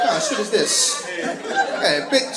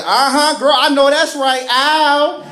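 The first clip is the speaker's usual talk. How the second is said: higher, louder sassy cheer; sassy girl voice; 5 semitones higher, 3 dB louder, slower than usual. Retold or imitated. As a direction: hype it up like a loud girlfriend.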